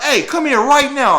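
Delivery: high, loud barked order; police shout. Retold or imitated. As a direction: make it an order now. Cop repeats order harder.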